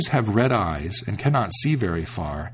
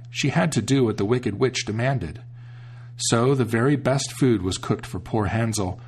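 ordinary delivery, nothing marked

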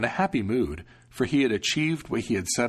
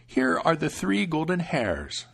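put-on higher, slow, gentle old woman; old grandmother; 7 semitones higher, slower than usual. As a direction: protective grandmother, unhurried. Grandmother hands hairs.

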